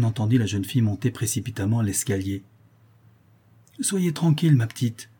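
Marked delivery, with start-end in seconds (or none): 3.8-5.1 put-on lightly sour, pitched-up old portress; a touch of wheezy shrillness; sour breathless voice. A narrator's reading. Portress calls up from below, mildly sour breathless voice. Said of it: "voix aigre, poussive, essoufflée".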